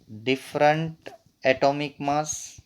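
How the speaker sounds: very slow, broken up for note-taking; dictation pace. Real, not acted. Teacher repeating a definition for note-takers, slow dictation. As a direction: pause after the first word, measured.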